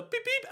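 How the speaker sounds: quick high car-key beeps; imitated car beep. Podcaster imagines unlocking the car, imitated beep beep.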